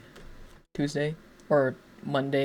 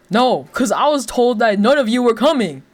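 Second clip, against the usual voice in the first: exasperated retort; 8 semitones higher, 7 dB louder than usual. Retold or imitated. Annoyed retort to cousin retold.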